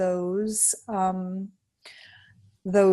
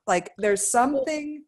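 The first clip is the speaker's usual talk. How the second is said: usual speaking voice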